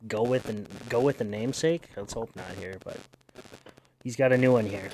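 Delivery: ordinary talk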